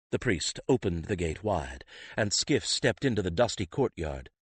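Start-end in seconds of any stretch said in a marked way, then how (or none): none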